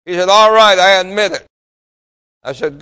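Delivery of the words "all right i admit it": a grudging outburst of surrender; reluctant loud admission. Retold or imitated. Smoker gives in to the pastor, a loud reluctant admission. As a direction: blurt it out louder, giving in at last.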